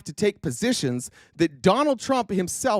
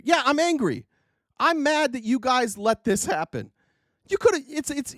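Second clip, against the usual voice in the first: ordinary talk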